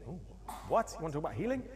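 mock incredulous what, rising; acting the startled pastor; surprised pastor. Pastor hearing healing is on the agenda, mock disbelief.

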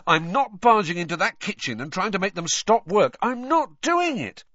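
high-pitched protest, slowing at the end; acted exasperation; indignant refusal. A narrator's reading. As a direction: refuse point-blank, stressing the last words. Boy refusing in an argument.

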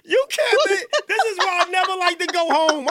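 words breaking up through real laughter; laughing. Real, not acted. Host cracks up at a guest's story, laughing hard.

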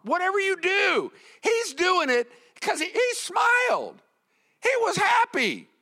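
high, loud exhortation in bursts; full-force preaching. Real, not acted. Preacher praising a happy toilet cleaner over beggars, fired-up. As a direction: punch each short phrase like a fired-up preacher.

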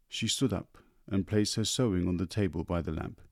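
plain reading voice

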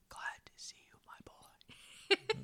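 soft-spoken dad's whisper. Retold or imitated. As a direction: breathe it out, barely audible. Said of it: "except he's like".

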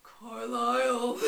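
loud, drawn-out call of a name; hammed-up slow cry; iconic quote impression. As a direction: call out melodramatically, stretching it. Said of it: "So what's the iconic quote?".

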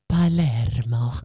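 put-on slow, loud Italian-accented Palermo; exaggerated Italian accent. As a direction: overdo the Italian on Palermo, mocking the fuss. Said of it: "why does it have to be like".

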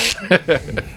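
soft, wordless duck squawk; Donald Duck quack. Shown as a demonstration. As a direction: quack from the side of the mouth. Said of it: "quack that shit".

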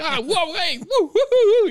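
high, loud mock-panic cries; comic startled yelps; freaked-out yelping. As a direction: whoop like something ran up your leg. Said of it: "it just freaked me out real fast".

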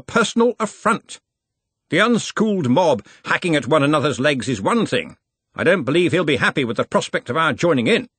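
put-on a hearty old wizard in charge; Ridcully's voice.

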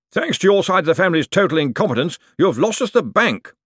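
high-pitched indignant accusation; accusing outburst. A narrator's reading. Relative blaming Cosmo's side of the family, high accusing voice. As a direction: throw the blame across the room.

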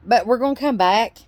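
loud, rousing sign-off speech; rhymed toast. Acted. Podcast host toasts listeners at show's end, loud and rousing. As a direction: raise a glass and rally the listeners.

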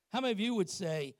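ordinary delivery

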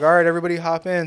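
fast, loud call to the group; quick call out. Retold or imitated. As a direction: hurry them in cheerfully. Coworker George calling swimmers.

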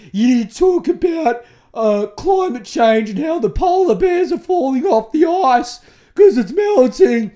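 high, loud nagging listener voice; mock preachy listener. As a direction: lecture in a whiny, scolding mock tone.

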